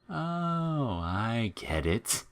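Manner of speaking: soft slow sneer into a high cackle; a twisted flower realizing, delighted; creepy creature laugh. A narrator's reading.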